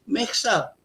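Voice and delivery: ordinary delivery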